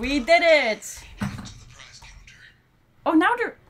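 relieved shout of victory; excited. Real, not acted. Survived the night in game.

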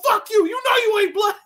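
high, loud mock yell; hammed-up furious shout. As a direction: bark it at the kid, hammed up.

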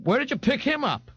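put-on very high, rowdy girl's voice; brash, mocking Frida. A narrator's reading. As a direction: jeer the question high. Frida mockingly questions Dorian.

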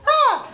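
sudden very high yelp; jazz hand scream. Shown as a demonstration. Comedian demonstrating his startled scream. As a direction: shriek like someone just jumped you. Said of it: "followed by this noise".

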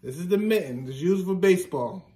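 ordinary talk

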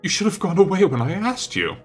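high, heated reproach; angry reproach. A narrator's reading. Painter blaming friend for staying, angry reproach.

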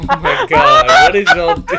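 high-pitched words through laughter; squealing laugh. Real, not acted. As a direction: laugh out loud while asking. Laughing hysterically.

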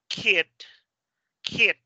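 KIT, short with a T puff; final T. Shown as a demonstration. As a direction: KID said as "kit", crisp T release. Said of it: "Se tiene que oír la, el t".

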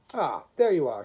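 booming, brisk greeting; calling out. A narrator's reading. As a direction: hail someone across a platform.

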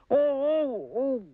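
very high yelps of hurt; drawn-out high storybook "ow"; cries of pain. A narrator's reading. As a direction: yelp high, as if your foot was stepped on. His foot is stepped on.

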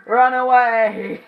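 drawn-out high loud run away; loud slow cry. Real, not acted. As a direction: wail it while fleeing. Fleeing in game.

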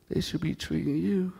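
ordinary delivery

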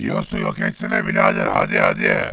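a tough robot with no tune in the voice; gruff toneless robot. Acted. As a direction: speak like a machine, threatening.

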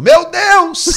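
sudden high, loud cry to God; panicked cry. Retold or imitated. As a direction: yell it in terror as the plane drops. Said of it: "o ateu que tá do meu lado gritou". (grito desesperado, bem alto e agudo)

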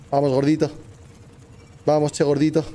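ordinary talk

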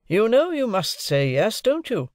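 high, slow leading question; pressing lawyer. A narrator's reading. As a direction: corner the witness into agreeing. Lawyer presses ward to admit it.

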